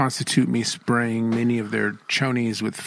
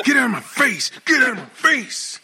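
mock angry shout; 11 semitones higher than usual. Man rejecting a compliment, mock angry high shout. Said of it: "someone's like, "Man, you're handsome".

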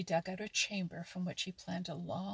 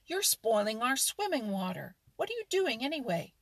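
angry shout; 3 semitones higher, 8 dB louder than usual. Acted. Otter and mink yell angrily at the muskrat for muddying water. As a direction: yell at him for muddying the pool. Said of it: "they shouted at him angrily".

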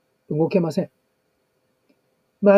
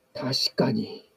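dad agreeing; 6 semitones lower, 9 dB quieter, much slower than usual. Retold or imitated. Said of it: "パパも".

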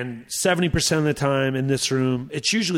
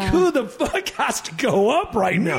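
high, drawn-out alarm; hammed-up backstage panic; mock panic; 9 semitones higher than usual. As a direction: dread who is next, pitched up and slowed. Retold dread of following Rodney.

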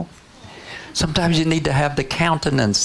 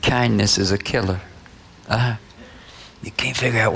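normal talking voice

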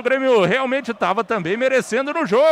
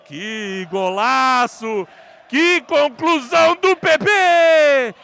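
explosive goal commentary; 6 semitones higher, 3 dB louder than usual. Real, not acted. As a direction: erupt as the ball hits the net. Goal call in live radio commentary.

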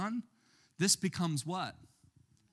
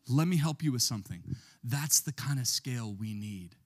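normal talking voice

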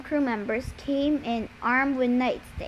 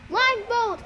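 high, urgent call; yell; 10 semitones higher than usual. A narrator's reading. Boy yelling to friends as sirens wail. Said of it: "I yelled".

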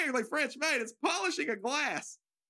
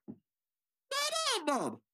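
very high, drawn-out silly butler voice; butler's greeting; 13 semitones higher, much slower than usual. Acted. Butler opens the door to a guest, high silly greeting. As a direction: sing out a slow, high-pitched welcome.

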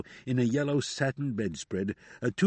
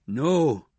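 a firm, louder "No"; 3 semitones higher, 6 dB louder than usual. A narrator's reading. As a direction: declare it so the whole room hears. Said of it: "in a voice that could be heard".

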